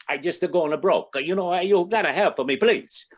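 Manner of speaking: high, loud pleading in a put-on Italian accent. Desperate Italian guy begging the saint for help, Italian accent. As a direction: beg like a joke's little Italian guy. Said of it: "it's a little Italian guy".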